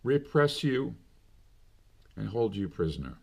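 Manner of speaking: ordinary talk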